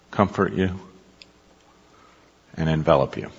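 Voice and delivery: soft, hushed meditation voice; guided meditation. Acted. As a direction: draw them gently into calm. Meditation on divine presence.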